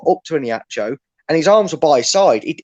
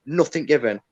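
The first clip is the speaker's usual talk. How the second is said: normal talking voice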